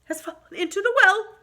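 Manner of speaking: put-on high, breathless little boy; panting child. Chang panting the long name to his mother, high and breathless. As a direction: rush it out of breath. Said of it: "he panted".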